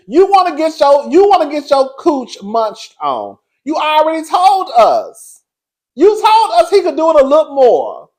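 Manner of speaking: high, loud, slow accusing call-out; mock call-out. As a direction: drag her out loud and slow, word by word. Calling out Doctor Alicia.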